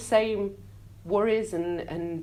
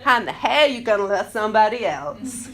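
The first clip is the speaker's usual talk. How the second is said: louder, laughing American drag-queen sass; 2 semitones higher, 7 dB louder than usual. Retold or imitated. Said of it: "I did the head and everything".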